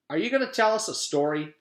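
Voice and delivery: lightly voiced eager child. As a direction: play a pushy kid.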